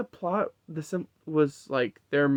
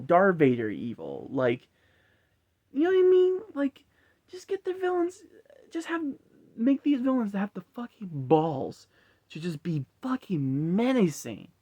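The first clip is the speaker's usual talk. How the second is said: ordinary talk, nothing marked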